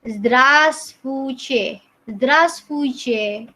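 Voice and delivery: "здравствуйте" drawn out very slowly, falling at the end; slow Russian greeting. Shown as a demonstration. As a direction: model "здравствуйте" slowly for the class. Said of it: "Nah, ini". (ucapkan "здравствуйте" sangat pelan)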